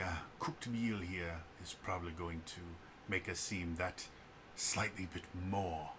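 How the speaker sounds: soft, measured officer addressing the group; brief them quietly and formally; Declan. Acted. Game master voices Declan.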